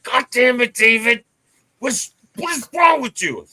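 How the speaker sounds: loud, high, exasperated outburst at her husband; wife scolding her husband loudly. Retold or imitated. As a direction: blurt it out like a fed-up wife. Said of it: "she'd be like".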